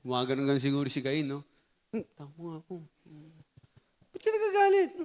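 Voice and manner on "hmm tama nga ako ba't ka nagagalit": teasing put-on voice. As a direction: play a silly squeaky questioner for laughs. Playful voiced figure, laugh follows.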